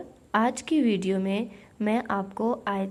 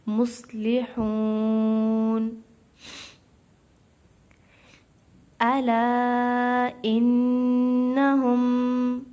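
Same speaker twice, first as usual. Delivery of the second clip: Quran recitation; much slower than usual. Acted. Teacher recites for students in slow chant. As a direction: draw every syllable out like a reciter.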